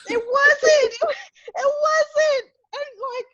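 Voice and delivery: high, cracking laugh-cry on the words; half laughing, half crying at how absurd it was; laughing in disbelief. Real, not acted. Telling the co-host it really happened, laughing and near tears. Insisting it was not a joke.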